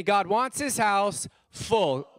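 preacher leading the crowd in a slow chant; call and response. Shown as a demonstration. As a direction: lead the room in unison, slowly. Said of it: "Say it with me".

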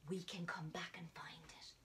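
hushed conspiratorial whisper to the class; secret whisper. Acted. Teacher whispering the magic box ritual to children.

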